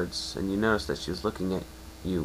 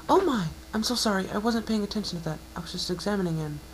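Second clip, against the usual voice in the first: much higher, flustered apology in a rush; flustered mare; 12 semitones higher than usual. Acted. Unicorn mare apologising to the blushing pegasus, flustered and much higher. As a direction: catch herself, embarrassed and apologetic.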